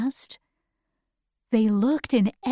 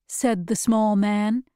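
ordinary delivery

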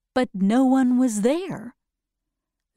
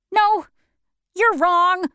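higher, louder, insistent little man; Oz correcting Dorothy; 8 semitones higher, 5 dB louder than usual. Acted. Oz telling Dorothy she is wrong, higher insistent voice. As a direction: cut her off firmly in a higher voice.

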